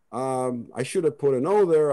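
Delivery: ordinary talk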